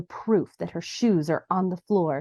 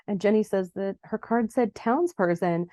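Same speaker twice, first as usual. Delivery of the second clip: ordinary talk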